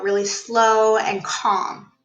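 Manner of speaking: slow, calm, unhurried voice. Shown as a demonstration. Coach demonstrating a client's slow, calm talk. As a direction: drop into a slow, calm pace. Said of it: "talking like I am right now".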